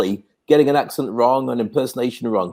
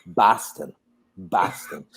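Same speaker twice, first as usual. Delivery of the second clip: put-on low Boston accent, quieter on the repeat; Frank Mulcahy; 8 semitones lower, 6 dB quieter, much slower than usual. Friend revealing his hometown to a Brit, Boston accent. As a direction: say it like a Bostonian, pitched down. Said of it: "I didn't know what a Boston accent sounded like".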